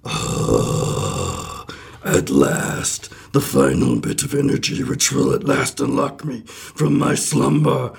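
slow eerie rasp, rising to release; a haunting ghost finally freed; Muppet-like ghostly voice. Acted. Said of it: "You sound like a Muppet".

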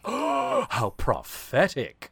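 a squealed oh, then slow and wry; gasp, then drawl it knowingly; mock awe. Real, not acted. Host marvelling at a prophetic line. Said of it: "I'm like, gasps".

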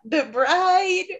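high, flustered blurt. Real, not acted. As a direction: blurt the title in a panic. Grasping for a book title.

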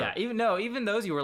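high and loud, cutting in; emphatic. Real, not acted. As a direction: insist eagerly. Eager interjection.

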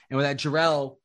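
normal talking voice

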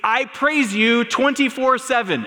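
high, loud indignant outburst; angry all-caps tweet. Retold or imitated. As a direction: shout it like a furious tweet in capitals. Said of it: "he tweets".

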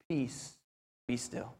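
very soft, low, calming command. Retold or imitated. Jesus answering the cry for help, calm. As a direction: hush the storm gently, dropping low. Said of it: "He calmly gets up and he says".

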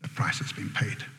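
very soft, low whisper; hushed. Real, not acted. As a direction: whisper it with solemn weight. Hushed climax after a long pause.

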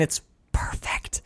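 hushed, breath-only delivery; awed whisper. Real, not acted. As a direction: drop to a reverent whisper. Awed verdict on favorite line.

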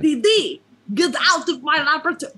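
high, loud cartoon Dexter in muddled French-Russian accent; rushed, high-pitched mock French-Russian accent; Dexter's Russian-tinged French accent. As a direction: yell it quickly as a Russian faking French. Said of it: "you're impersonating a Russian doing a Fre-, French accent".

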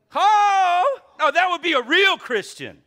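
loud, high, laughing cry; laughing shout. Real, not acted. Pastor cheering the ideal helpful church member, laughing loudly. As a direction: whoop it out with a laugh.

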